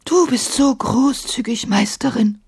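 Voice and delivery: high teary thanks, sniffing; sniffling. A narrator's reading. Genie thanks Emma tearfully, sniffling. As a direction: genie sniffles his gratitude. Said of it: "schniefte der Dschinn".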